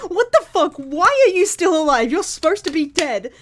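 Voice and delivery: loud, high-pitched mock outrage; retold anger. Retelling Suzaku finding Lelouch alive, imitated fury. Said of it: "he gets immediately really mad".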